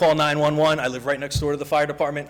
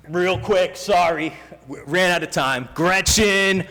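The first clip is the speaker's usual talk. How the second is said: louder and higher, calling out; calling out loudly; 6 semitones higher, 5 dB louder, slower than usual. Real, not acted. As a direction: call her name across the room. Rushing, calling out to councilwoman.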